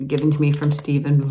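normal talking voice

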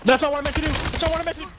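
shocked shout. Real, not acted. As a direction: cry out in real shock. Shouts after a game blunder.

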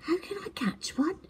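high, quick, whispered question; little owl whispering. A narrator's reading. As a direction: ask eagerly under breath. Twit asks.

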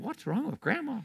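put-on a five-year-old tugging at his dad; little boy asking. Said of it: "and I'm looking at my dad and said".

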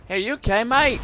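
put-on playful Australian accent, rising high. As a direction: check on a foe like an Aussie mate. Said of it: "I'm stuck on an Australian accent".

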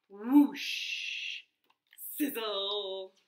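whoosh sound effect. Acted.

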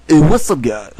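loud, high radio DJ greeting; a punchy radio host hello; radio guy voice. Pretend. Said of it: "get my voice like a radio guy".

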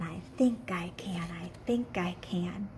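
unvoiced, fading to a whisper; a teacher fading the chant down to a whisper. Shown as a demonstration. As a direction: drop into a whisper for the kids. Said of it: "Can you say that with me?".

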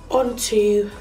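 ordinary talk, nothing marked

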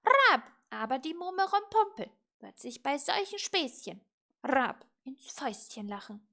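loud, high squawky caw; warning raven. A narrator's reading. Raven warning witch about her rival, croaky and loud. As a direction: punch out each caw, then lecture on.

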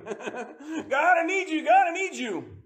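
mock needy prayer.

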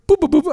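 high mock beeping "Boop, boop, boop"; phone beeps. Host imitating his phone going off mid-break, mock beeps. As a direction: chirp out phone tones.

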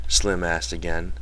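usual speaking voice

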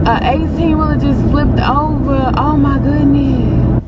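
shocked, high cries of alarm; startled outburst at the crash on the road; real shock. Real, not acted. Rolling over glass, truck flipped.

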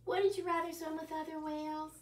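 plain reading voice

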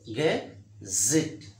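"Get. Zit." as two separate beats; syllable by syllable. Shown as a demonstration. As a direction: pause between "Get" and "Zit". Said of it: "Two syllables are there".

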